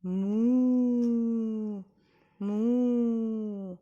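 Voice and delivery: put-on a cow mooing for little children. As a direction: moo like a friendly cow. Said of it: "The sound is like this".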